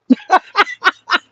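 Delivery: a burst of high laughter; gleeful cackle. Real, not acted. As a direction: cackle at your own ruthlessness. Laughs at own ruthlessness.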